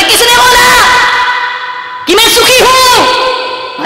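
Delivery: swan's anguished outburst; swan crying out. Acted. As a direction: cry it out as the grieving swan.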